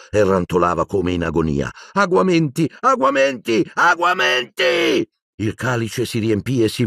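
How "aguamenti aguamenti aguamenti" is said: high repeated cries, last one drawn out; frantic incantation. Acted. Boy conjuring water for dying professor, frantic incantation. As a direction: cast it desperately, over and over.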